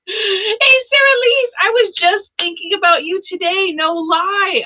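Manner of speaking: high, excited squeal of welcome; delighted greeting. Real, not acted. Spots a friend joining the stream.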